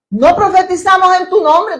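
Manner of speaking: pleading false prophet. Acted. Quoting those who claim miracles.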